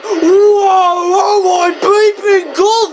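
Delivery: high, drawn-out mock freak-out; over-the-top excitement. Reviewer acting out the thrill of the first speedway, over-the-top excitement. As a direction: freak out at top pitch, stretching the words. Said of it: "it's like".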